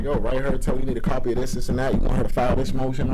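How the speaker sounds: loud, pushing advice; urgent loud advice. Retold or imitated. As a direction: press him to act, loudly. Older inmates coaching him.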